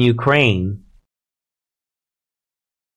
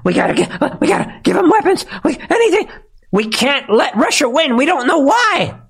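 much higher, frantic mock voice; hammed-up high panic voice; mock panicking officials; 13 semitones higher than usual. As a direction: squeal it like a panicked bureaucrat. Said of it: "why they're panicking about this".

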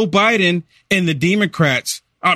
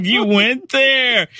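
slow, higher teasing drawl; 6 semitones higher, much slower than usual. Real, not acted. Teasing his co-host for the joke, in a slow drawl. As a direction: drag it out, scolding playfully. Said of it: "Where did you get that from?".

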